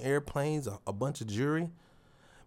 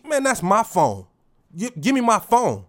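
loud, higher, forceful; snatch it back with your voice; confronting a phone thief, loud and firm; assertive demand; 7 semitones higher, 9 dB louder than usual. Retold or imitated. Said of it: "but nah, you gonna be".

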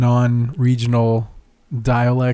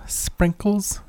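whispered Scottish sprinkles; 7 semitones higher, 5 dB quieter than usual. Shown as a demonstration. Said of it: "Scottish sprinkles".

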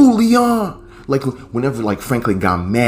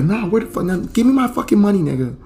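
angry woman yelling; 13 semitones higher than usual. Retold or imitated. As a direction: rattle off the threats at him. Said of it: "she's like".

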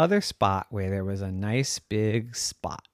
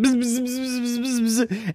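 high, fast bee buzz; excited buzzing; 12 semitones higher than usual. Acted. Excited bee buzzing around the cat, high buzz. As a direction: buzz all worked up. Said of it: "started buzzing".

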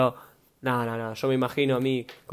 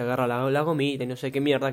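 ordinary delivery

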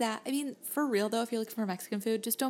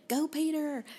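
put-on little girl cheering; 6 semitones higher, 3 dB louder than usual. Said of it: "the little girl kept yelling".

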